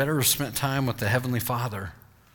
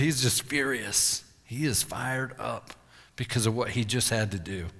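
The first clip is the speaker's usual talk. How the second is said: usual speaking voice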